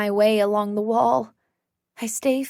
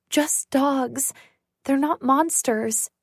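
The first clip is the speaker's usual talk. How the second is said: ordinary delivery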